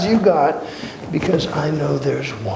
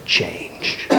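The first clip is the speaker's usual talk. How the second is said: hushed; 6 semitones lower, 7 dB quieter than usual. Acted. Hushed emphasis on God's constancy.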